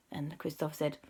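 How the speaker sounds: usual speaking voice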